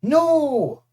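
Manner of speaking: high loud furious no; shouted. Acted. Clarice rejects mercy, loud high shout. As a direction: bark the refusal. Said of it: "Clarice shouted".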